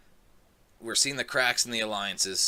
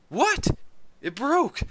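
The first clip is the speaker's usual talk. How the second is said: much higher, louder disbelieving yell; snapping outburst; 7 dB louder, slower than usual. Retold or imitated. Negan snapping over his broken bat, yelling high. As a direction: explode in outrage. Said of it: "he kinda snaps".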